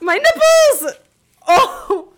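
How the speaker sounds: high, startled yelp from the cold ice; squealing in shock at the cold; real shriek. Real, not acted. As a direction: shriek as the ice hits skin. Ice still in shirt.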